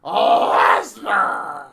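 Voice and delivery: Paul Heyman. Acted. Advocate introducing the new undisputed champion, loud and drawn out. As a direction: draw out each title like a bombastic ring announcer. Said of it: "my name is Paul Heyman".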